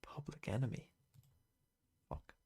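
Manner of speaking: breathy whispered answers to self; hushed self-quizzing whisper. Real, not acted. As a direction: whisper it to yourself. Muttering answers under breath.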